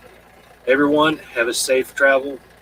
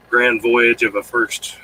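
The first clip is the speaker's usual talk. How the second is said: normal talking voice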